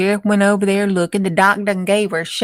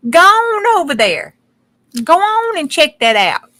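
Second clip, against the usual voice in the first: bossy order; 8 semitones higher, 5 dB louder than usual. Acted. Sheriff ordering his deputy.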